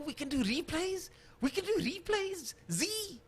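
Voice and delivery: high-pitched, incredulous question; surprised delight. Real, not acted. Commentator finding out the producer has replays, excited surprise. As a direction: squeal it in happy disbelief.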